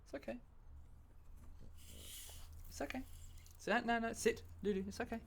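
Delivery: very high, hushed reassurance; calm a frightened dog softly, pitched right up; small, soft comforting voice; soothing a pet. Real, not acted.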